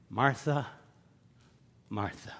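low, fading double name; exasperated sigh. Retold or imitated. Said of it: "That's an exasperation".

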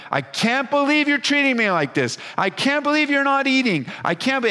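high, whiny voice of a sulking body; complaining body. Retold or imitated. Pastor voicing the hungry body to the congregation, high whiny complaint. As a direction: whine like an offended stomach. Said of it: "your body is just like".

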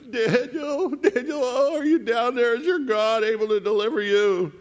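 hammed-up half-crying wail, pitched very high; acted high-pitched anguished cry; lamentable, half crying. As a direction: call out plaintively as if close to tears. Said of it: "with a lamentable voice, he's half crying".

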